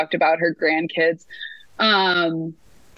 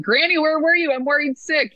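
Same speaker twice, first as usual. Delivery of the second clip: higher, lightly worried call to a late grandma; mock worried granddaughter; 8 semitones higher than usual. Granddaughter teasingly scolding granny home at five a.m., mock worried. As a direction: play the fretting grandchild, half joking.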